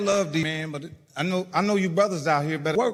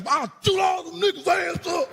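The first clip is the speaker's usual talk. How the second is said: boasting shout; 11 semitones higher than usual. Retold or imitated. Tough guy boasting to girl.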